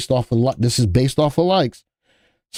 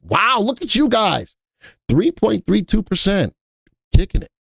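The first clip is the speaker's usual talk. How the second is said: excited praise; 8 semitones higher than usual. Real, not acted. Viewing viewers' portfolio gains.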